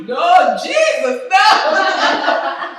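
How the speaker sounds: high, loud mock plea to Jesus. Mother begging Jesus for a husband, mock shout. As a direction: cry out to Jesus in mock despair. Mom pleading, retold in story.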